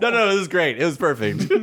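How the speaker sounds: laughing. Real, not acted. Laughing reply.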